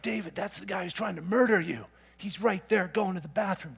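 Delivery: eager men whispering to their leader; urging soldiers. Retold or imitated. David's men urging him in the cave, hushed and eager. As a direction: nudge him toward the kill, hushed. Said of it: "David's men are like".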